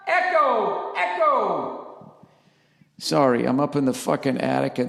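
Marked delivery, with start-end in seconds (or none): 0.1-2.1 playful echo call. Acted. Testing the attic echo aloud.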